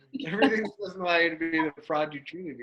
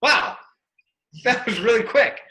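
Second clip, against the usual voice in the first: amazed; 8 semitones higher, 7 dB louder than usual. Retold or imitated. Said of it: "I was like".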